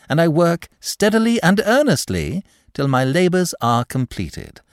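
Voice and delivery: high-pitched, emphatic self-praise; suave, earnest clerk. Acted. Clerk assures manager of his steady work, earnest. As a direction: declare your diligence grandly, easing off at the end.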